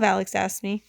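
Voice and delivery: ordinary delivery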